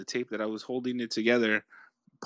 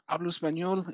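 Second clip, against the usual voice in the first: imitated Spanish speaker; 8 semitones higher than usual.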